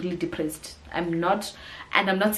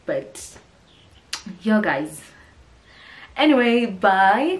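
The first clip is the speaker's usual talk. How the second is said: ordinary talk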